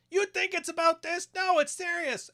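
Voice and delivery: mimicking his girlfriend's protest, high and whiny; mocking girlfriend. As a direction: squeak it like a put-upon girlfriend.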